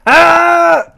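normal talking voice

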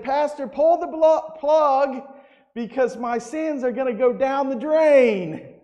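high, loud and thrilled; new convert excitedly calling to the pastor; excited exclamation. Retold or imitated. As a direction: blurt it out overjoyed. Joyful woman after baptism.

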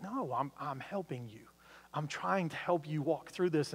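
soft-spoken reassurance as Jesus; gentle Jesus voice. Retold or imitated. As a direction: speak as Jesus calming a friend. Preacher voices Jesus replying gently.

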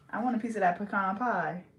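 ordinary talk, nothing marked

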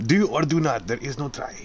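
badly faked little-master accent; worst Yoda. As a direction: do a hammed-up, botched wise-alien voice. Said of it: "That was, like, the worst Yoda ever".

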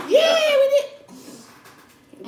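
very high, loud, drawn out; imitating Aisha. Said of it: "She's like".

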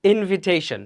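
ইনভিটেশন said "in-vi-TAY-shon"; correct pronunciation. Shown as a demonstration. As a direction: model ইনভিটেশন with a short "vi". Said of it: "এটা কিন্তু ইনভাইটেশন না".

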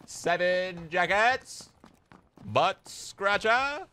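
slow high drawn-out cry. Pretend. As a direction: stretch it out slowly. Drawn-out exclaims.

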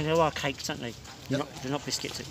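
ordinary talk, nothing marked

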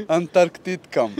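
loud joke, then a high burst of laughter; laughing. Real, not acted. Contestant cracking up at his own joke. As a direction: blurt it out and crack up.

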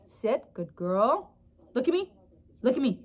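usual speaking voice

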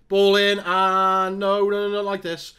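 a fan watching disaster unfold, shouting; loud dismay. Real, not acted. Opponent cross, fearing a goal.

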